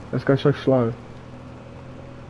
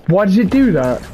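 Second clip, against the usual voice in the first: higher, louder exasperated cry; frustrated yell; 6 semitones higher, 4 dB louder than usual. Real, not acted. Gamer frustrated at game.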